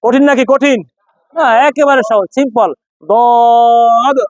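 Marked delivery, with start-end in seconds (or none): 3.1-4.2 দদ called out long and strong; Arabic dad sound. Shown as a demonstration. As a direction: hold দদ firmly. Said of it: "তা বলেন".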